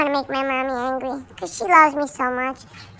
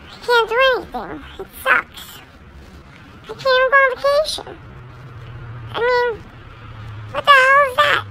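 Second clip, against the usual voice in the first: higher, whining over being stuck at home; whiny complaint; 10 semitones higher than usual. Acted. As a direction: pitch up and whine it out. Dog complaining he can't go out.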